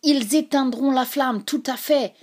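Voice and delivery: a cried warning. A narrator's reading. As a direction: call out the threat. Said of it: "cria-t-il".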